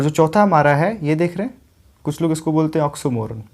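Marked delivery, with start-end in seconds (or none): 2.9-3.4 ऑक्सीमोरन as ox-o-MO-run; common mispronunciation. Retold or imitated. Teacher quotes what some people say, ऑक्सीमोरन as ox-o-MO-run. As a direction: use o for the second vowel of ऑक्सीमोरन, short ending. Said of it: "ये ऑक्सीमोरन नहीं होता है".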